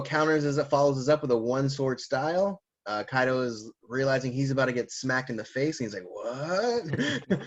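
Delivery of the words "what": high, drawn-out mock disbelief; shocked what. Recapper acting out a villain about to be hit, mock shocked. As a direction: stretch it out and swoop upward in shock.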